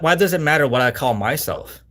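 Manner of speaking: ordinary talk, nothing marked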